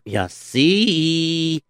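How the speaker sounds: ordinary delivery, nothing marked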